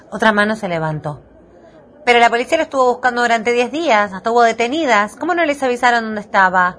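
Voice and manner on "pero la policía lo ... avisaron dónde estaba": raised pitch, quick pressing question; insistent press-conference questioning; challenging reporter. Acted. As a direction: fire a challenge at the spokesman.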